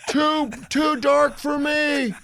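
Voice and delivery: high, loud, drawn-out caricature of bicyclists; high-pitched, stretched-out mock holler; mock yelling. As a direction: holler it slowly in a high mocking voice. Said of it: "You can hear 'em yelling".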